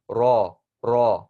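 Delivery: raw with a heavier, fuller R; heavy ra. Shown as a demonstration. Teacher drilling the heavy Arabic ra. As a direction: weigh down the R in raw. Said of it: "making the R sound more heavy".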